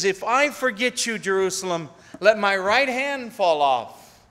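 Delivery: loud, high scripture proclamation; impassioned preaching. Acted. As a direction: proclaim God's promise with fervor. Preaching at full force.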